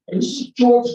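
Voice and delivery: high, loud impersonation; George Gallagher impression. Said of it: "do my George Gallagher impression".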